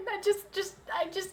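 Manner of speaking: choking up through a small laugh; a little emotional. Real, not acted. Streamer promising viewers the ornaments will be special, choked up. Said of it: "I got a little emotional there".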